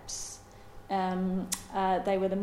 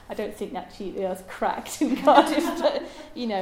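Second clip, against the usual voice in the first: chuckling through the words; laughing; 3 semitones higher, 7 dB louder than usual. Real, not acted. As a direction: laugh while you say it. Laughs at own Cardiff joke.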